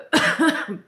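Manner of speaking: sharp real cough; one harsh cough; loud cough. Real, not acted. Apologises after coughing.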